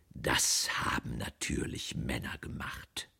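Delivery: low, slow, toneless muttering; dull, hollow. A narrator's reading. Defeated aunt blaming men, low and dull. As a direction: drop it down low and lifeless. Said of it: "sagte sie dumpf".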